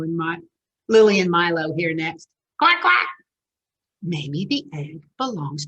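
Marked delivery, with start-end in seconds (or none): 2.6-3.1 high, louder quacking; duck quack. Acted. A duck calls to Lily and Milo, high quack.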